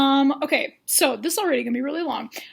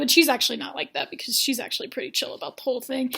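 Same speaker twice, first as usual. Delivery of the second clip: usual speaking voice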